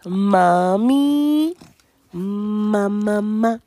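put-on gently prompting a toddler to say mama; coaxing a baby. Shown as a demonstration. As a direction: coax the baby to repeat. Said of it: "you say mama".

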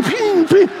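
high ringing gunshot imitation; ping out each little shot; imitated BB gun pings. Little brother firing a BB gun, imitated pings.

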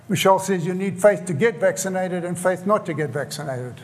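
ordinary talk, nothing marked

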